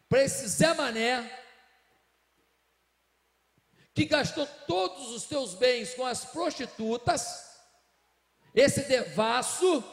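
slow, loud, bitter outburst; angry older son. Acted. Older brother raging at his father, slow and loud. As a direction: spit out each phrase in resentment.

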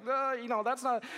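mock a client starting to protest; imitated hesitant protest. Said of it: "the client's like".

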